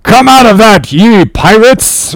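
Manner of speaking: high, loud roared command; captain's roar. A narrator's reading. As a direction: roar it out, drawing out the last words. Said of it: "roared the captain".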